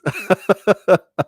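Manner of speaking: amused chuckle; helpless little laughing fit. Real, not acted. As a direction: break into a high chuckle. Amused by the hillbillies.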